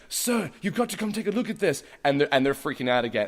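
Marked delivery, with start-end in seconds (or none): 0.1-1.8 soldier voice. Retold or imitated. Voicing soldier in film scene.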